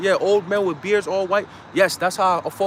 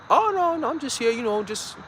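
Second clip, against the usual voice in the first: high, fake-innocent cop voice; mock trooper; 6 semitones higher than usual. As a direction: play the sweet-talking officer. Imitating a state trooper.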